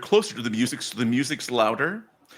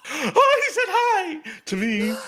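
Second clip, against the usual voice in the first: very high squeal of delight; high-pitched, laughing swoon; starstruck fan; 4 dB louder, slower than usual. Acted. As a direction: gush giggly and high over being noticed. Starstruck follower reacts to greeting.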